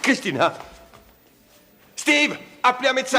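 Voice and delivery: loud, high alarmed shout; calling for help. Acted. Man shouting for a doctor as she faints, alarmed. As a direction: yell across the room for help.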